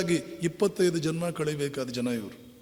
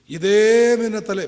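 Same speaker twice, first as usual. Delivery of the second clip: forceful exhortation; 4 semitones higher, 9 dB louder than usual. Real, not acted. Stirring listeners to change world.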